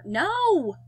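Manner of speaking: loud, high cry of refusal; emphatic shout. A narrator's reading. Narrator stops his own tempting thought, emphatic shout.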